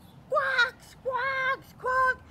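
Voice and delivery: high, loud squawking parrot. Acted. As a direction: screech it like a parrot.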